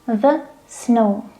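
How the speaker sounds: THE said "thuh" before snow. Shown as a demonstration. Teacher demonstrating the article before a consonant, THE as "thuh". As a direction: say "thuh" for THE, with a schwa. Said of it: "S is consonant sound".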